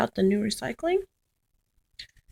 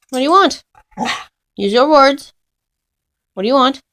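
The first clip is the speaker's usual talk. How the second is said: loud questioning; 5 semitones higher, 10 dB louder, faster than usual. Real, not acted. Dog whining, owner calling out.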